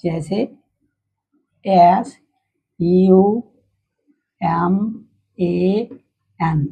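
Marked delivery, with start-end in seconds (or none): 1.7-6.7 letter names एस, यू, एम, ए, एन one by one with pauses; spelling out letters. Shown as a demonstration. Teacher asks pupils to read the word, spelled out. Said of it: "इसको क्या पढ़ोगे".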